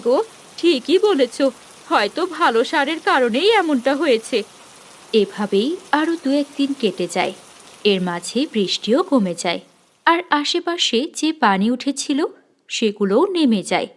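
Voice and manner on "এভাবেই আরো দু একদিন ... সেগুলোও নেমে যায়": calm storyteller's narration; a plain storybook narrator voice; story narration. A narrator's reading. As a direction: tell the passage of days evenly. Narrator bridges time.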